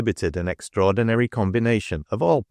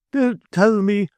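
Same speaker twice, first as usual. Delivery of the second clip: high, loud dismissal; said aloud; 13 semitones higher, 4 dB louder than usual. A narrator's reading. Mrs Sparsit brushing off Mrs Pegler's plea, loud. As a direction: cut off the plea, much higher and louder. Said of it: "said Missus Sparsit aloud".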